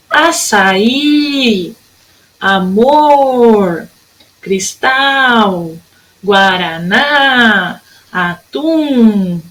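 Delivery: each word separate, final syllable stretched: "açaí", "amor", "cristal", "guaraná", "atum"; drawn-out endings on "açaí, amor, cristal, guaraná, atum"; stressed last syllables. Shown as a demonstration. Teacher listing words for pupils, long last syllable in "açaí, amor, cristal, guaraná, atum". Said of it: "Todas as sílabas fortes são as últimas".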